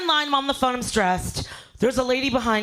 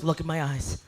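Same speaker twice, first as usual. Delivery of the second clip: low, soft, soothing; calm her down gently; 11 semitones lower, 6 dB quieter than usual. Retold or imitated. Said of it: "he goes".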